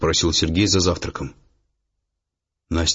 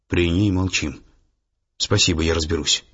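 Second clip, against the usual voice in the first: plain reading voice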